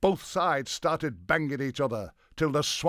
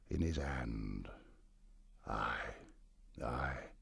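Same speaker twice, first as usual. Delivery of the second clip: mock-solemn mourning; 8 semitones lower, 4 dB quieter than usual. A narrator's reading. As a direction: sink gradually into a grave, quiet lament. Mourning dead Harold at story's end.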